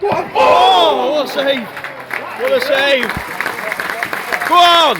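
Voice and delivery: high, loud cries of delight at a save; excited shouting. Real, not acted. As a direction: yell it out, thrilled by the save. Fan watching a last-minute save.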